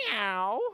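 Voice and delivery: rising imitated meow; cat meow. Shown as a demonstration. Teacher answering what cats say, cat meow. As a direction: mew like a cat for the children. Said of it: "What do the cats say?".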